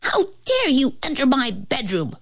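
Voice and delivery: high, indignant royal cry; outraged king. A narrator's reading. King to the cook who walked in, indignant cry. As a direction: protest in a high, affronted king's voice. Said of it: "cried the king".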